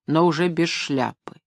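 plain reading voice

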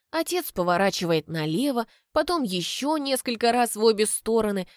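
ordinary delivery

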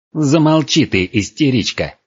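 irritated dragon roaring for silence; annoyed, forceful outburst; growled command. A narrator's reading. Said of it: "рыкнул дракон".

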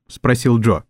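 usual speaking voice

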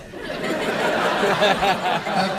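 cracking up mid-sentence; burst of laughter. Real, not acted. Comic cracking up at his own jab to the crowd. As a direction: laugh breaks through.

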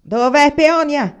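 high, drawn-out, booming call; powerful shout. A narrator's reading. Father searches for Peonia, shouting powerfully. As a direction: shout the question out slowly and forcefully. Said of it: "gridava sempre suo padre con voce potente".

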